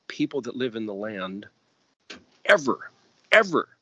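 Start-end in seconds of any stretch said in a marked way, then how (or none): 2.4-3.7 loud, high insistent repeat of ever; emphatic ever. Acted. Teacher warning his listeners, loud and insistent. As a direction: drive home never ever, louder and higher.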